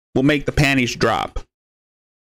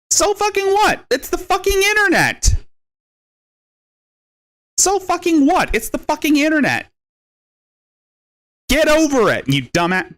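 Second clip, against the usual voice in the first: much higher, sneering mock voice; mocking retort; 10 semitones higher than usual. Taunting a rival online, in a much higher mocking voice. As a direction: squeak it out like a taunt.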